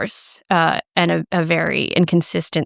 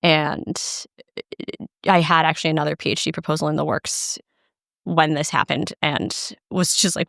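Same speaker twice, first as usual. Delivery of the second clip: ordinary talk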